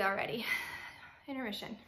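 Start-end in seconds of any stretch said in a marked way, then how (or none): 0.9-1.8 catching her breath. Real, not acted. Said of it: "I need to breathe".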